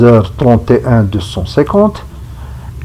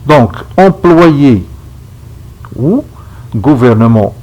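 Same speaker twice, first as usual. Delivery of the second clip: usual speaking voice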